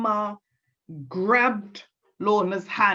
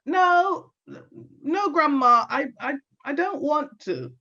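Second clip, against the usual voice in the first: put-on nervous girl; 6 semitones higher than usual. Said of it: "So she said".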